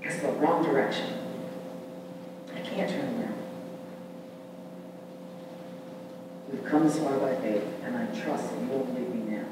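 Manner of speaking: quiet inner resolve. Retold or imitated. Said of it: "and you say to yourself".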